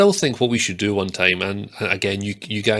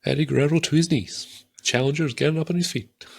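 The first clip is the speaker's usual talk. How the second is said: higher-pitched ringside commentary; mock sports commentator; 6 semitones higher than usual. Fan trying out commentary for his co-host, mock commentator voice. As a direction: call the match like a TV announcer. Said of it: "we should commentate over it".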